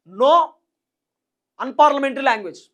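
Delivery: each word drawn out very slowly; slow firm order. Retold or imitated. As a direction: spell out the party's ban word by word. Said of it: "అధిష్టానం క్లియర్గా చెప్పిరట".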